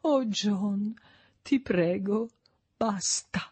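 soft, pained and tense; plaintive, strained plea. A narrator's reading. Mother pleads with her son, soft and plaintive. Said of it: "in tono lamentoso, penosamente teso".

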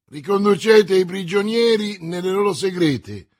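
high, very loud order; commanding. A narrator's reading. Commissioner sending prisoners back to cells, commanding. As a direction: bellow the order to the guards. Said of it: "ordinò il commissario".

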